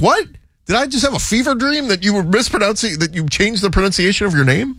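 high-pitched incredulous question; baffled disbelief. Retold or imitated. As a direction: act out baffled disbelief at a changed name. Acts own baffled reaction.

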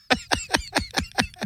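a sudden high giggle; a high laugh right after her own joke. Real, not acted. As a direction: break into a high little laugh.

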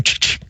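imitated "chick, chick" verbal tic; tongue-click sentence ending. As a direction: end on two quick clicks. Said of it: "end his sentences with".

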